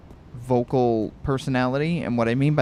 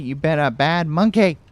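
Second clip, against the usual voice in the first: raised, louder playful telling-off; mock scolding; 5 semitones higher, 5 dB louder than usual. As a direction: scold it like a naughty pet. Mock scolding a game enemy.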